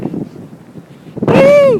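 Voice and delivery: high, loud joyful whoop; excited whoop. Real, not acted. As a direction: let out a wild cheer. Cheering after first blizzard.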